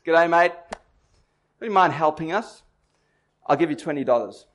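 put-on friendly Aussie "G'day mate" in an Australian accent. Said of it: "in a great Australian accent says".

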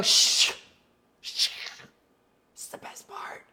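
urgent whispered shush; hushing whisper. Retold or imitated. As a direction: hush her so the best part isn't missed. Hushing daughter during the film.

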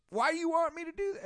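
very high, strained drill-sergeant demand. Retold or imitated. Drill instructor questioning the little kid, strained high demand. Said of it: "And he goes".

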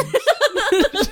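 high breathy villain cackle; evil cackle. Acted. Villainous cackle after quote.

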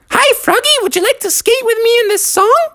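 very high, quick flirty lady voice; a coy fox, very high and fast. A narrator's reading. Lady fox asking Froggy to skate, very high and flirty.

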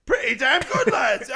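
very loud, high mock roar; shouted approval. Hooligan approving the song to his lads, shouted. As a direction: erupt in imitated hooligan praise. Retold praise erupting into shout.

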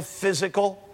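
usual speaking voice

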